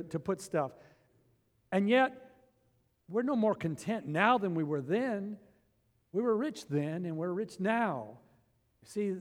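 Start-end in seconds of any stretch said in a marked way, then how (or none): none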